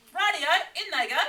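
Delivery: Dingo, greedy and delighted; eager Dingo. Acted. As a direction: toss them in with glee.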